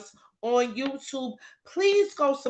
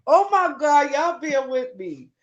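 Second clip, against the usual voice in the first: ordinary talk, nothing marked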